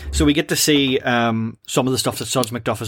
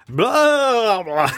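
high, loud ghostly "Blah, blah"; hammed-up scare noise; spooky blah; 10 semitones higher, 3 dB louder than usual. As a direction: boo them like a mock ghost. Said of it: "Homer repeatedly trying to scare Lisa".

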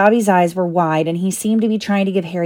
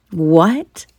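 stammering. A narrator's reading. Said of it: "Harry stammered".

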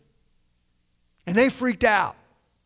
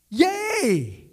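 mock cheer; 8 semitones higher than usual. Mock cheer ending his retort.

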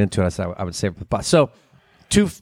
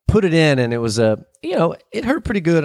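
normal talking voice

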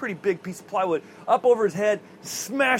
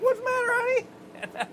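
very high, drawn-out mock wife voice; imitated wife. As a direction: squeak it as a concerned wife, hammed-up. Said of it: "And his wife is like".

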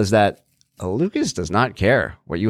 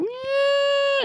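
very high, drawn-out imitated squeal; long, high-pitched whine-squeal; baby elephant squeal. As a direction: imitate an upset child trumpeting like a baby elephant. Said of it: "I used to call it the baby elephant".

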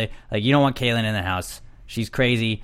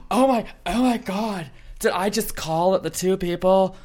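mock shocked Caitlyn; 8 semitones higher than usual. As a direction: gasp it like she's caught. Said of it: "which is like".

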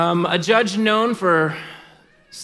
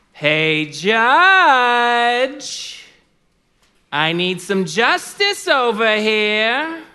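loud, high, drawn-out holler; widow hollering for justice; 6 semitones higher, 4 dB louder, much slower than usual. Acted. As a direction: call out brashly, stretching the last word. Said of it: "That's how she would say it".

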